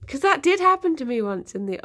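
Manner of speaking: normal talking voice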